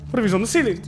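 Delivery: high anxious question; scared horror game streamer. Real, not acted. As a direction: ask it frightened and tense. Repeated frightened question.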